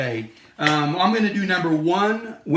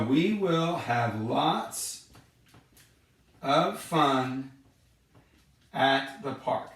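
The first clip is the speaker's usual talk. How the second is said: very slow and softer, word by word; slow, spaced-out reading; 5 dB quieter, much slower than usual. Shown as a demonstration. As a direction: spell the sentence out slowly for the kids.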